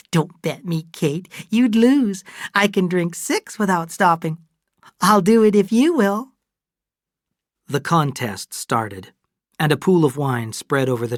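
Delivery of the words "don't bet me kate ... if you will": put-on higher, louder tipsy older woman; lightly voiced merry boast; Fay boasting. A narrator's reading. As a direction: brag to win the bet.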